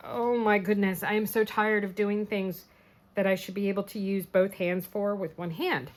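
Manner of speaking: ordinary delivery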